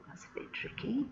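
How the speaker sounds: a soft confiding whispered aside. Real, not acted. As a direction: whisper it to the class as a secret. Reader's aside to the children.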